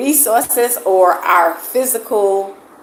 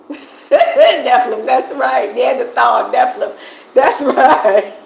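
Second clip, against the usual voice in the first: giggling through the words, higher and louder; laughing; 4 semitones higher, 3 dB louder, slower than usual. Real, not acted. Streamer laughs at a viewer repeating her joke, laughing through it. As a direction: laugh it out while agreeing.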